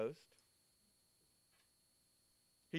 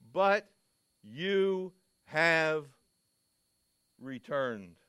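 But and you have said loud and drawn out; emphatic But; 8 dB louder, much slower than usual. Shown as a demonstration. Preacher quoting Peter, loud emphasis on the word But. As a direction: land on But, then lean into you have. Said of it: "is one of the greatest words".

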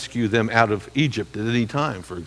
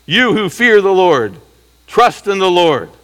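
louder worship leader call; 4 semitones higher, 5 dB louder than usual. Shown as a demonstration. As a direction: proclaim it louder, like a leader calling the assembly. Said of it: "the worship leader addresses".

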